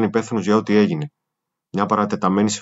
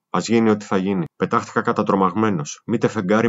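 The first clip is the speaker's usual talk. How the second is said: ordinary delivery, nothing marked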